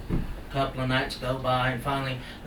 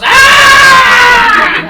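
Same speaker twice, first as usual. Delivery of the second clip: long, very high loud scream; drawn-out shriek of terror, played big; falling scream; 21 dB louder than usual. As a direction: scream as if dropped from a treetop. Animal pushed out of tree.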